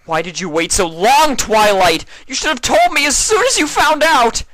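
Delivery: high, loud furious shout. Acted. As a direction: yell it at her, hurt and angry. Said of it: "Spike shouted".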